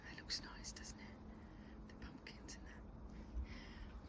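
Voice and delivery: soft unvoiced whisper. Real, not acted. Vlogger whispering over the pumpkins, then noticing she's whispering. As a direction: hush it right down, barely breath. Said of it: "I don't know why I'm whispering".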